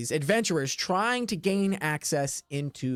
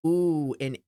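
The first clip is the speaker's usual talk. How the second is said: usual speaking voice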